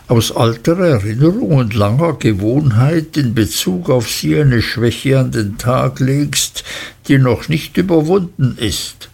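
ordinary delivery, nothing marked

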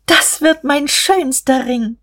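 put-on high, slow, rejoicing girl's voice; jubilant. A narrator's reading. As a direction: let it ring out with joy. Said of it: "Da jubelte Guste".

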